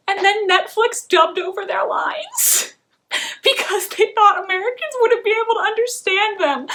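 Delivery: rising up in incredulous glee at the absurdity; amused outrage. Real, not acted. Indignant about Netflix dubbing actors.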